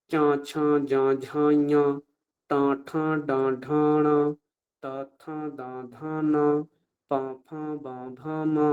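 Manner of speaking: each syllable from চা to মা sung out evenly through the nose; nasalised pronunciation. Shown as a demonstration. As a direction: nasalise every vowel from চা, ছা through ভা, মা. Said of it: "অনুনাসিক করে উচ্চারণ করি". (অনুনাসিক উচ্চারণ)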